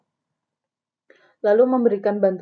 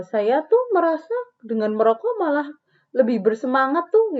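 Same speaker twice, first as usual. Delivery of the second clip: mock client; 7 semitones higher, 4 dB louder than usual. Shown as a demonstration. Smoker client voiced in example.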